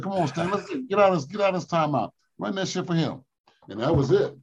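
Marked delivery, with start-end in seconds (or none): 0.0-3.2 higher mock of the Iceman; Iceman's voice. Iceman urging Stan to end the timeout, higher voice. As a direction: wave the coach off, pitched up. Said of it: "He said".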